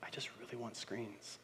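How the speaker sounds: put-on whispered, pleading kid; whining whisper. Upset son whispering a plea to dad. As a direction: beg for screens in a whisper. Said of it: "Cohen gets upset and he goes".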